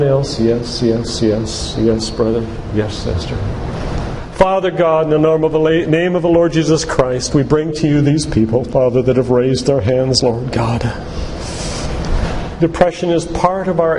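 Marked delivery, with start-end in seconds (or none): none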